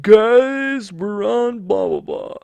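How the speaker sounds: loud, high-pitched mock excitement; mock announcement. Host telling listeners they got accepted, hammed-up excitement. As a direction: shout the good news, then drop off into blahs. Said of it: "and I'll be like".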